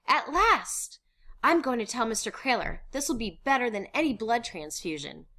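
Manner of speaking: high, loud, joyful; elated relief. Acted. Eager to tell Mister Kraler the news, elated. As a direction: rejoice that it has finally come.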